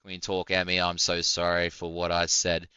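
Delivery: ordinary talk, nothing marked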